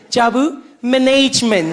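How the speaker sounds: "management" as muh-NAYJ-ment; switched pronunciation. Acted. Corrector deferring to Jabu after the election, "management" as muh-NAYJ-ment.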